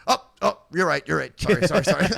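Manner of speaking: light impression of startled backing down; startled apologetic man. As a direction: lightly imitate someone backing off with quick sorries. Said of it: "he'll go like this".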